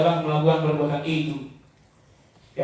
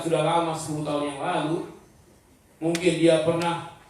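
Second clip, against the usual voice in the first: usual speaking voice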